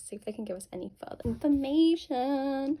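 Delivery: ordinary talk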